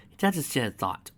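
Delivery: plain reading voice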